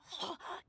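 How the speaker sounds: a quick, sharp intake of breath; surprised gasp. Acted. Registrar recognizing the famous traveler, gasps in surprise. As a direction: gasp as if spotting a celebrity.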